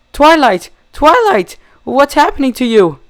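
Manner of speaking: high desperate crying shout; sobbing scream. A narrator's reading. Rarity trapped, calling to Twilight in desperate cries. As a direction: cry out to her in terror. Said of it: "Rarity began sobbing".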